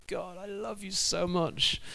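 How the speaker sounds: high, soft, slow doting cat voice; adoring cat. Retold or imitated. Cat tells God its love, high, soft and slow. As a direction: coo it to God.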